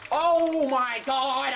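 drawn-out, high wail of "oh my God"; exasperated outcry. Real, not acted. Exasperated cry-out during gameplay.

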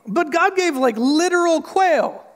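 high, loud, whining complaint; whiny Israelite. Retold or imitated. Israelites complaining to God in the desert, high mock whine. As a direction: whine like a spoiled grumbler.

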